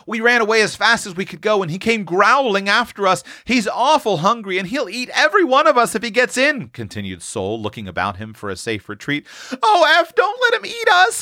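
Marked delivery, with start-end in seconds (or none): 0.0-6.7 put-on high piping child's voice; small child hiding, piping out her fear; piped out. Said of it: "piped out". 9.6-11.2 put-on very high, pleading little girls; little girls begging Eph to save them, crying out; frightened cry. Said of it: "cried both little girls".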